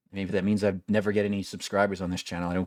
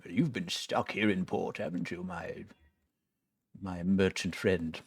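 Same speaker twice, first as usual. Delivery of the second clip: a hint of a sly old sailor; pirate voice. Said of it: "I keep doing the pirate voice".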